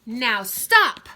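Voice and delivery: a loud, firm stop to the wild rumpus; firm loud order. Acted. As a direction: shut the party down with one command. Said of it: "Max said".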